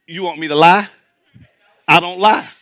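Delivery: usual speaking voice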